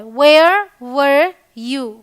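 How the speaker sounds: drawn out word by word, very slow; slow teaching demo. Shown as a demonstration. Teacher showing learners how to ask where were you, very slow. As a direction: lay each word down slowly for students to repeat. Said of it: "तो simple है".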